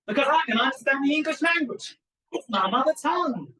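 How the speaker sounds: loud, high-pitched acted comeback; indignant retort. Senator answering the witness's challenge, loud and indignant.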